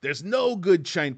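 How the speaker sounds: high, loud, slow New York whine; mock a homesick New Yorker griping about Los Angeles; exaggerated New York accent moaning about LA; New Yorker complaining. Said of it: "they come out and they go".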